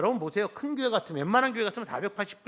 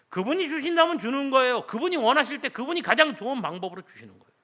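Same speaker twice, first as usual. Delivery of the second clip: preaching at full force; 9 semitones higher, 8 dB louder than usual. Real, not acted.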